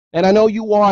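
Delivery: heated. Real, not acted. Ranting at fans about the team.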